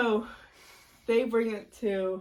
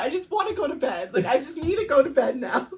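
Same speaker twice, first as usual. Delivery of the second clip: exasperated whine; 7 semitones higher, 8 dB louder than usual. Retold or imitated. Overtired whine retelling to friend.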